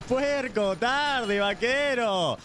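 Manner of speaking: usual speaking voice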